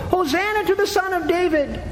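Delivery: very high-pitched imitation of children's cheering; squeaky mock child's cry; children shouting. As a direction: mimic excited kids chanting in a high voice. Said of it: "who were shouting in the temple".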